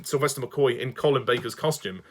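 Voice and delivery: normal talking voice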